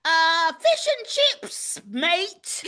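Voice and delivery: mock British accent, high and slow; bad British accent. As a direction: ham up a Cockney fish and chips. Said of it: "I'm gonna work on my accents".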